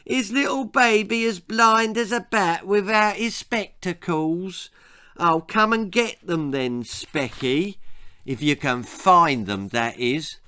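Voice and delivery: mock him like a crying baby; a bully taunting a crying boy in baby talk. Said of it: "he mocked".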